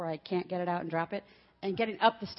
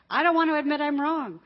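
stubborn refusal; 6 semitones higher, 8 dB louder than usual. Retold or imitated. Voicing stubborn human nature.

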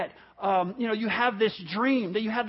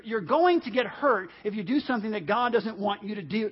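usual speaking voice

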